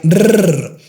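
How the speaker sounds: "rrr" as a strong long trill; rolled R. Shown as a demonstration. Teacher showing the strong R. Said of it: "pronounced very strong".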